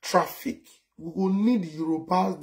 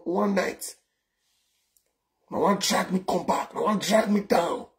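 ordinary talk

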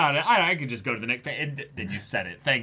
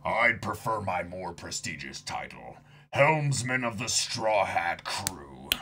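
Jinbei voice; 3 semitones lower than usual. Acted. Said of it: "reading Jinbei's lines".

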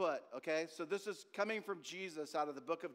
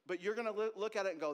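normal talking voice

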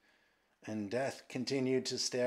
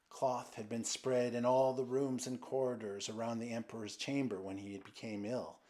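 usual speaking voice

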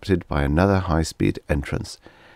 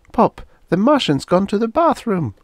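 put-on much higher child's voice, bursting with news; a small boy blurting out what he saw; eager little boy; 4 dB louder than usual. A narrator's reading. Little son running in to tell his father, eager child voice.

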